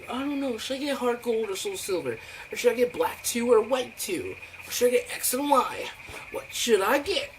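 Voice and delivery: put-on high dithering kid voice; mocking indecisive kid. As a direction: whine through the choices. Mocking indecisive kids' choices.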